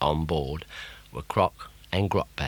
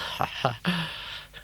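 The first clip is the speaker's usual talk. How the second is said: witch cackle; 10 semitones higher, 5 dB quieter than usual. Acted. Witch laughs.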